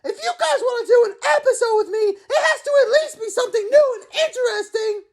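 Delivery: Naruto. Acted. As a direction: yell it out, cocky and impatient. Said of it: "It is Naruto!".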